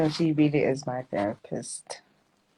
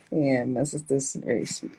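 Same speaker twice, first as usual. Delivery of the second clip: normal talking voice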